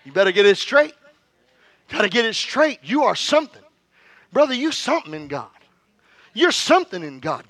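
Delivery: loud, high, emphatic sermon delivery; rousing preacher's proclamation; preaching. Real, not acted. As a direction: drive every phrase home to the congregation. Preaches at full force.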